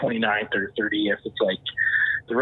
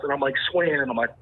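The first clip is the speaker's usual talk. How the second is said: ordinary delivery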